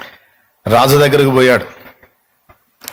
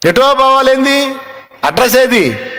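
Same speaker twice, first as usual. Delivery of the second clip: mocking friend; 10 semitones higher, slower than usual. Listeners mock his vague trip.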